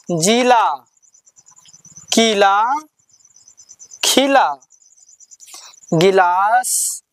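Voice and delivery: teacher leading a class word drill; reading drill. Shown as a demonstration. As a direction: call out each word for pupils to repeat. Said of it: "सब लोग बोलिए मेरे साथ".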